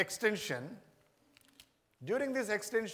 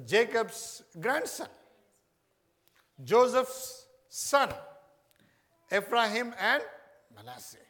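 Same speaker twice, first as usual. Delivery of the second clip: slow, loud, each name hammered; emphatic teaching; 5 semitones higher, 7 dB louder, slower than usual. Real, not acted. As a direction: drive the names home to the congregation.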